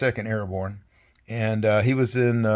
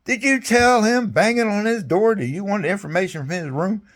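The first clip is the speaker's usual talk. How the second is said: much higher mock general voice; a hammed-up bark of command; the general to his soldier, mock stern and high; imitating the general; 10 semitones higher than usual. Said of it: "He goes".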